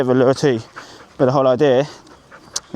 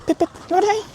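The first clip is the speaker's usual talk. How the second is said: much higher, bright call to the dog; faster than usual. Real, not acted. Trainer calling her puppy to come, high sing-song call. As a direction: sing the name up high to get the puppy's attention.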